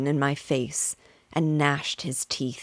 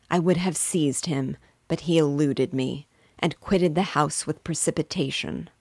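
ordinary delivery

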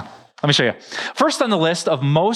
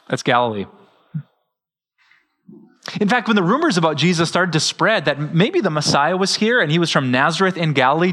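ordinary talk, nothing marked

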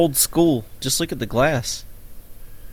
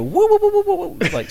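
high squealing woo-woo imitation; high didgeridoo whoops; faster than usual. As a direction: whoop it like a spun tube. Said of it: "and you take it and go".

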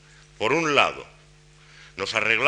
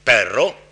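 "Perro" said louder with a rise; final rise on perro; 8 dB louder than usual. Shown as a demonstration. Lecturer isolates the rising last word. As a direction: let "Perro" climb upward. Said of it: "aplicando el esquema ascendente".

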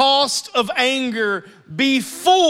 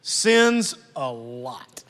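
"sins a lot" drawn out very slowly and lower; punchline emphasis; 10 semitones lower, 3 dB quieter, much slower than usual. Shown as a demonstration. Pastor landing the proverb's punchline, slowly stretched. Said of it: "watch this".

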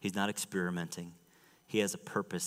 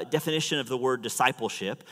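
usual speaking voice

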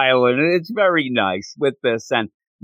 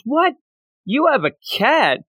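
shocked squeak; 11 semitones higher than usual. Retold or imitated. He is shocked about cat.

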